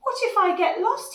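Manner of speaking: high, loud fretting voice; worried little dinosaur. Acted. Little dinosaur thinking he might get lost, acted worry.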